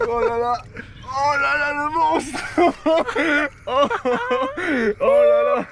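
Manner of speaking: high, loud oh là là; thrilled at a huge catch; amazed excitement. Real, not acted.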